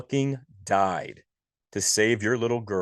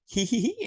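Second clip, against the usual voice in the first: very high mock giggle; a creepy little girl's tittering. Imitating Catherine's giggle.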